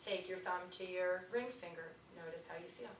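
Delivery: soft, quiet meditation voice; guided meditation. Acted. Teacher guiding the class through finger touches. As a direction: guide them softly through each finger.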